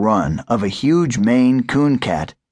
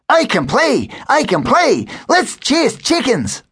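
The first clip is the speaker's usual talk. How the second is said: much higher, eager puppy voice; excited puppy; 14 semitones higher than usual. A narrator's reading. Sir Walter begs to play, high and excited. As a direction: bounce with puppy glee.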